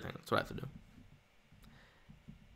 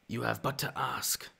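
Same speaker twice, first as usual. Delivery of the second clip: Maul voice; 8 dB quieter, slower than usual. Pretend. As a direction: draw it out with quiet menace. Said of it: "I actually have a decent Maul voice".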